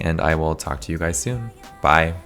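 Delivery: normal talking voice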